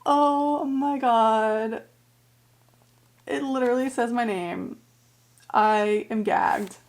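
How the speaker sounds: loud, drawn-out real shock; stunned amazement. Real, not acted. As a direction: stretch it out, floored by the gift. Finds her name in signed book.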